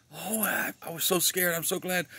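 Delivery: high-pitched, flustered woman's voice; nervous lady passenger. Retold or imitated. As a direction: imitate a scared flyer relieved to land. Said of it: "was quite nervous".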